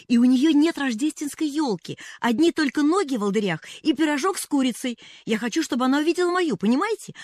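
put-on high, loud, rushing girlish chatter; girl babbling eagerly to Mrs Carew about her friend; rattling on. A narrator's reading. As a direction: pour it all out breathlessly. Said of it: "затараторила девочка".

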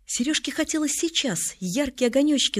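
ordinary delivery

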